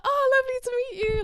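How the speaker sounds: very high, loud mock-sweet greeting; forced politeness. Greeting the supposed stepsister, high forced politeness. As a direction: gush a fake polite hello.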